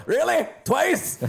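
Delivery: squeaky high disbelief; incredulous mayor. Acted. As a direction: pitch way up in disbelief. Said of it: "he goes".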